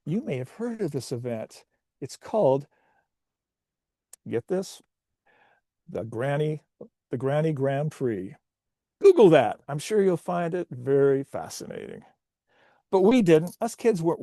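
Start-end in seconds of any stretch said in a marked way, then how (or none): none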